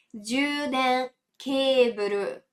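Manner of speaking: juden keburu spelled out very slowly; teacher's slow model of juden keburu; slow demo. Shown as a demonstration. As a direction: draw out each syllable for the learner. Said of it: "Uma palavra um pouquinho mais longa".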